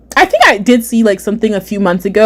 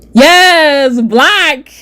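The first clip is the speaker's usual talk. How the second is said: high, drawn-out mock proclamation; Doctor Umar; 7 semitones higher, slower than usual. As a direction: proclaim it in a hammed-up impression. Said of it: "Doctor Umar voice".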